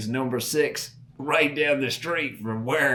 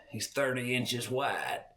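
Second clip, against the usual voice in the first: put-on softer, understated boast of the old timer; quiet smug boast; 7 dB quieter than usual. Old hunter to the boy, quiet and smug. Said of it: "he's like".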